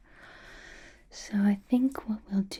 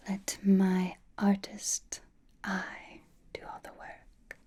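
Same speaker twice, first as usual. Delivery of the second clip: ordinary talk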